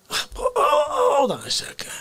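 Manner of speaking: high mock sob; fake-cry the words. Character breaks down crying.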